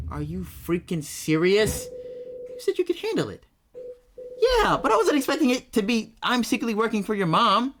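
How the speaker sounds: loud high disbelieving outburst; indignant outburst. Acted. Ari confronting her roommate over the secret, loud. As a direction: blow up at the roommate.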